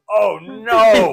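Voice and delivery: loud, high imitation of dismay; mock dismayed shout. Man in the car reacting to the heckle, hammed-up shout. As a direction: cry it out, hammed-up.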